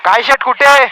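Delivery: loud high call to a friend. Real, not acted. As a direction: shout across the road to a passing friend. Calling out to a friend.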